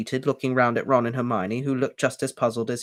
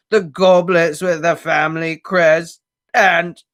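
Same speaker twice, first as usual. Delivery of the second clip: slow, rising, louder anguished elf wail; grieving house-elf; 3 semitones higher, 7 dB louder, slower than usual. A narrator's reading. Elf wails the stolen treasures to Harry. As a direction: build the list toward breaking.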